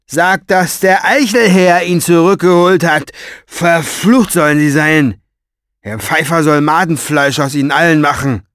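loud pompous villain, boast turning to outrage; indignant Orpheus. Acted. Orpheus curses the rabble who deny his credit, indignant. Orpheus rants about the mob.

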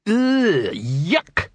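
high, disgusted troll yuck. Acted. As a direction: spit out the yuck. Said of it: "he said".

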